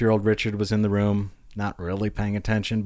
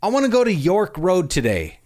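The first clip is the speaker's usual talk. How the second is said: put-on eager child; 7 semitones higher than usual. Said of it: "He says".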